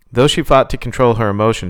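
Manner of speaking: plain reading voice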